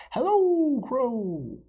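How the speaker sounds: high, loud, sweet fox voice calling up; sly fox calling. Acted. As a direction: call up to the bird, all charm. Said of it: "he called".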